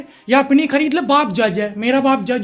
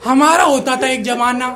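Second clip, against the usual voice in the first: gossiping aunty; 4 dB louder than usual. Retold or imitated. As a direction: rant like a nosy aunty at a wedding. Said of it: "एक aunty है हमारी". (शादी में दुल्हन पर ताने कसती आंटी की ऊँची, तेज़ आवाज़)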